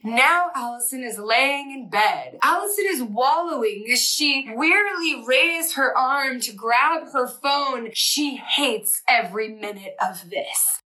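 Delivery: lightly voiced movie narration, slowing down; movie narrator voice. Shown as a demonstration. Allison narrating herself in bed, mock movie narrator. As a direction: narrate your own wallowing like a film. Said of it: "I narrate them like a movie".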